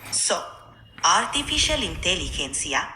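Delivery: put-on Spanish-accented speech. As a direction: roll it with Spanish sounds. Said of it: "switching to a Spanish accent".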